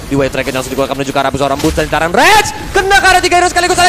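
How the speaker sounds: high, loud hype play-by-play; esports caster. Acted. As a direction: call the teamfight at full excitement. Excited play-by-play during teamfight.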